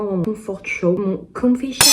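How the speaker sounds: English words in a put-on English accent; playful attempt at an English accent. Shown as a demonstration. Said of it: "je fais jamais l'accent anglais".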